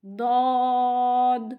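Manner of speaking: ضاد held long from the tongue side; drawn-out Dhad. Shown as a demonstration. Teacher demonstrating ضاد slowly to students. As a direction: stretch ضاد slowly, tongue edge on the molars. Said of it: "وہاں پہ جا کے touch ہونی چاہیے".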